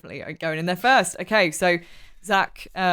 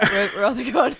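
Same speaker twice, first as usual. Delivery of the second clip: high, laughing mock bewilderment; lightly mocked confused quote; bewildered character; 5 semitones higher than usual. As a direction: play him lost, through a laugh. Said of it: "He's like".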